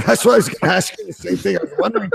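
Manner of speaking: talking through high laughter; giggling while speaking; laughing. Real, not acted. Laughing at the clip.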